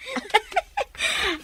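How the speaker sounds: a bright high-pitched chuckle; high laugh. Acted. Mother laughing while chatting with the neighbour girl.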